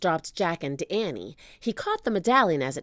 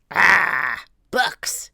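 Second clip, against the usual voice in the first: disgusted pirate growl; grumbling villain, loud groan first; Captain Bones; 7 dB louder than usual. A narrator's reading. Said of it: "growled Captain Bones".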